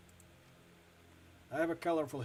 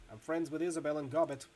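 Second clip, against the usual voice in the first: ordinary talk, nothing marked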